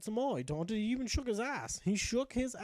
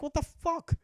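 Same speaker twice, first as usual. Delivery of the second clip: higher, fast indignant outburst; exasperated Hrothgar; 7 semitones higher, 3 dB louder than usual. Retold or imitated. Knight Hrothgar invaded again on the stairs, high exasperated outburst. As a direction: squawk it up high, fed up. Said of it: "Hrothgar was like".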